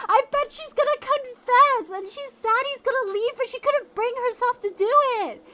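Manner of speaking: high, loud, slowed recollection; shouted retold guess. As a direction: yell the old prediction. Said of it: "I was like".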